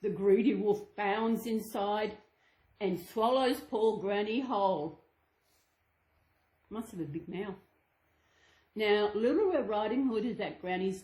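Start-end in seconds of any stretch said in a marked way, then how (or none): none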